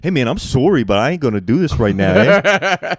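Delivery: imitating an irritated Canadian worker refusing a task; angry Canadian accent. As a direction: play an upset Canadian warehouse worker. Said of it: "mad at you in a Canadian accent".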